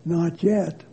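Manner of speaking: soft, low and unhurried; quiet calm reply. A narrator's reading. Master calmly refusing the teacup's plea. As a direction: say it gently, with a patient smile. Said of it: "He only replied".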